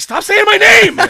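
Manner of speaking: high, loud mock shout; panicked plea. Student pleading in his head, panicked shout. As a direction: cry out in comic panic.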